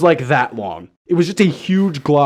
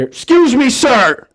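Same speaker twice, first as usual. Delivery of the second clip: put-on a higher raspy old lady calling out; 5 semitones higher, slower than usual. Old stranger at the hospital Subway interrupting his lunch, raspy. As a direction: lightly rasp out a polite stranger's request. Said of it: "in a real raspy voice".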